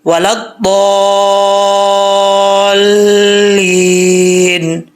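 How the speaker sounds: وَلَا-الضَّالِّينَ chanted slowly, ض lengthened and joined to the ل; slow Quranic chant; tajweed recitation. Shown as a demonstration. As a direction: recite ولا الضالین as a slow melodic chant. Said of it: "ضاد کو لمبا کر کے لام کے ساتھ کنیکٹ کریں".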